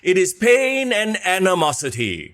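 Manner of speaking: drawn-out, high theatrical verse; rhyme performance. Pretend. Host opens the show with his own rhyme, theatrical. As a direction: chant it slowly, pitched high, like a dramatic rhyme.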